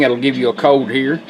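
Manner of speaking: ordinary talk, nothing marked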